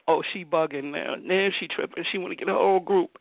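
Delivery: dismissive mockery. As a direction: brush the kid off with a smirk. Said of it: "Kyle was like".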